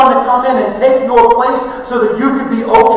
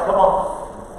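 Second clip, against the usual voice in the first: normal talking voice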